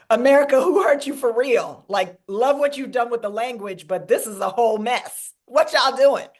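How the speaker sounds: loud, sassy call-out; playful, emphatic scolding in another persona; sassy alter ego. Acted. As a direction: exclaim it like a teasing girlfriend. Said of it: "Also, she was like".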